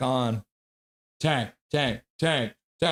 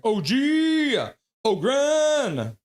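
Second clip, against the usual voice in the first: higher, louder drawn-out cheer; fan cheer; 9 semitones higher, 3 dB louder, much slower than usual. Retold or imitated. Streamer reads a chatter's cheer for Ogren, higher and louder. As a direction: call the name out like a fan in the stands. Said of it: "Casket Brain's like".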